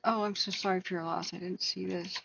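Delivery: normal talking voice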